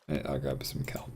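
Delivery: soft unvoiced whisper. Real, not acted. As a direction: breathe it out in a whisper. Whispered then repeated plainly.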